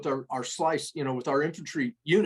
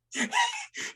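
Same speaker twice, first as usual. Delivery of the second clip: squealing high laugh at his own story; high burst of laughter. Real, not acted. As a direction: let out a high cackle. Story punchline, kid fell over.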